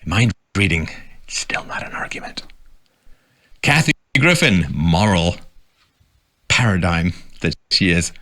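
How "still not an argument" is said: breathy whispered aside; dry stage whisper. Real, not acted. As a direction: drop to a whisper for the punchline. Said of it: "whispered aside".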